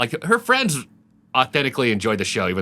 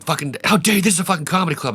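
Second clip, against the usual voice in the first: higher imitated indignation; losing it; 7 semitones higher, faster than usual. Promoter defends his club to heckler, mock fury. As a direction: tear into him as the outraged promoter. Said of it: "he just loses it on this guy".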